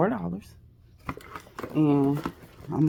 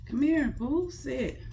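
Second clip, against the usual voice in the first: coaxing; 8 semitones higher, slower than usual. Real, not acted. Coaxing the dog to sit.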